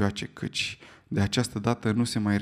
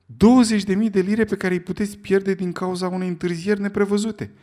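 high, loud urgent protest; alarmed warning; 8 semitones higher, 6 dB louder than usual. Acted. Sullivan warning Fogg about delays, high and loud. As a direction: warn him he could lose it all.

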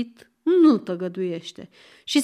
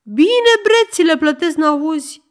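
angry shout; 6 semitones higher, 7 dB louder than usual. Acted.

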